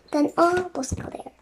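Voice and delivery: ordinary delivery, nothing marked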